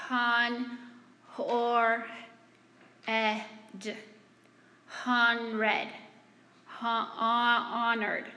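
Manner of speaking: slow, halting syllables "han, hor, ed" groping toward "honored"; imitating a young reader struggling to sound out "honored"; child sounding out a word. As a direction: stumble through "honred" before landing on "honored". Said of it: "She's going along".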